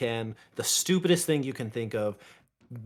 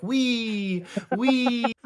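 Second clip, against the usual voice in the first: high, loud playful "Wee, wee"; mock roller coaster squeal; 11 semitones higher, 5 dB louder than usual. Mimicking partner on roller coaster.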